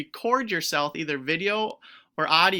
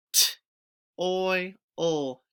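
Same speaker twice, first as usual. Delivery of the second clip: ordinary talk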